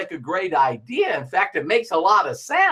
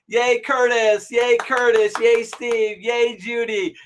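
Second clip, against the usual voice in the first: mild mock cheering; 4 semitones higher, slower than usual. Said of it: "then everybody goes".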